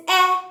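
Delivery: a single short, stressed "eh"; short e sound. Shown as a demonstration. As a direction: say "eh" short and open like in "egg". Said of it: "E says".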